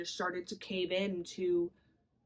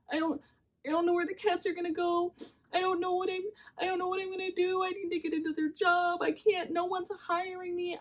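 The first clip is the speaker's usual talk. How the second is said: high, strained voice on the edge of tears; retold crying; 11 semitones higher than usual. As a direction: replay the panicked sobbing phone call. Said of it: "I'm bawling my eyes out".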